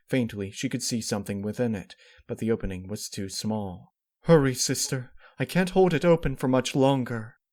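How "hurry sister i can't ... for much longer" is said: loud, high, urgent plea. Acted. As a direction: urge her on, straining. Straining to hold portal.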